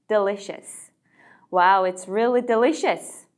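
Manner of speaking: "delicious" drawn out slowly; slow model sentence. Shown as a demonstration. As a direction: read it slowly for learners to follow. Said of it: "정말 맛있네요". (천천히 "delicious" 발음 시범)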